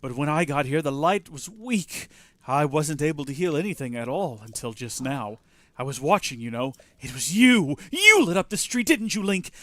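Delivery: lightly voiced game character. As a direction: read the NPC's lines with energy. Voices game NPC dialogue.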